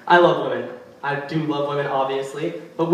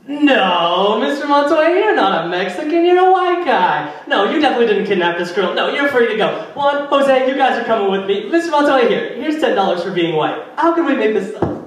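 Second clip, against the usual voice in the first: high, overly polite mock cop; fawning cop; 5 semitones higher than usual. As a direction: gush apologies like a groveling officer. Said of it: "they'd be like".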